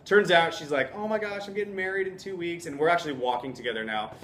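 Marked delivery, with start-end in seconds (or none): 1.0-2.7 a touch of put-on feminine delight; delighted woman. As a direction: lightly voice a thrilled bride-to-be. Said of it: "delighted that this is happening".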